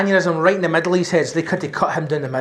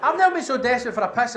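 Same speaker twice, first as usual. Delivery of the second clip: normal talking voice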